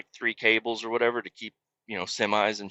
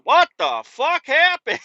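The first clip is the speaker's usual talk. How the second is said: slow, high, loopy just-woke-up voice; dazed; 7 dB louder, slower than usual. Retold or imitated. Driver coming to in the wreck, slow and high bewildered. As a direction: sound groggy and lost. Waking up dazed after crash.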